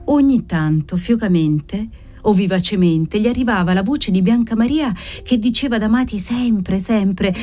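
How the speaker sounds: plain reading voice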